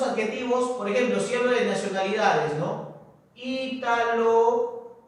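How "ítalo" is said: very drawn-out, held syllables; dictating slowly. Shown as a demonstration. Teacher writing a nationality example, dictating slowly. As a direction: hold each syllable, long pause between words.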